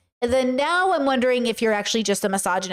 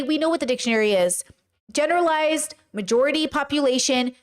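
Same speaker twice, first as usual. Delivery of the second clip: ordinary talk, nothing marked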